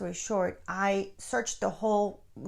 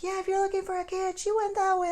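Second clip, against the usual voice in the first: high, quick, casual lady pointing the way; helpful stranger; 12 semitones higher, 4 dB louder, faster than usual. Retold or imitated. Bystander lady telling a frantic mother which way her child went. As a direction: pitch it up high and toss it off. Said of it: "She's like".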